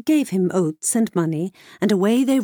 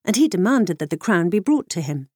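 plain reading voice

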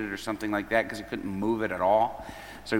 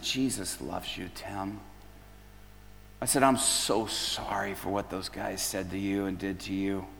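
tender comfort; 4 semitones lower, 8 dB quieter than usual. Retold or imitated. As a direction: speak low as if holding his shoulders. Comforting Tim, hands on shoulders.

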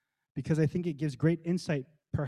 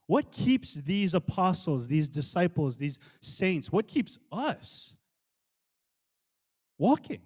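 ordinary delivery

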